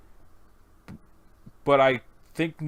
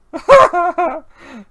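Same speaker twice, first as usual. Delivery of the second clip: high, loud burst of laughter; hammed-up character guffaw; loud cackle; 13 dB louder than usual. Laughing at a partner who fell for the joke, loud cackle.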